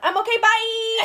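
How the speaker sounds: high, loud fake-cheery goodbye; hasty cheerful exit. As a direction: chirp it out and flee. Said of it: "and I went".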